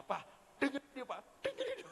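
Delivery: rengekan tinggi istri; istri membujuk. Pretend. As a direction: rayu suami dengan manja. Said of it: "kasih nanti suaminya". (istri merengek ke suami agar mendengar)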